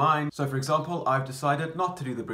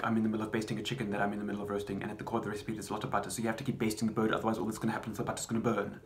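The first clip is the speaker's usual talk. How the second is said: rapid-fire, softer recitation; extremely fast; 5 dB quieter, much faster than usual. Shown as a demonstration. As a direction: race through it from start to finish. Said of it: "do the monologue extremely fast".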